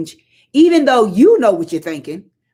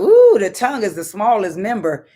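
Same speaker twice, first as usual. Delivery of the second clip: fervent apostle; 5 semitones higher, 3 dB louder than usual. Retold or imitated. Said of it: "James talking about".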